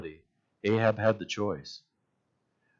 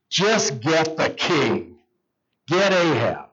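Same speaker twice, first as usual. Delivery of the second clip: mildly forceful command, slow and higher; battle order; 4 semitones higher, slower than usual. Acted. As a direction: send soldiers after one target, half-acted. Acted battle order to soldiers.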